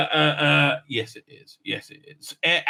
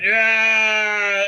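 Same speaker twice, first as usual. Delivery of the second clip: long, drawn-out raised cry; mock yell; 5 semitones higher, 5 dB louder than usual. Retelling a character's cry, mock drawn-out yell. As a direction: hold it out loud and higher.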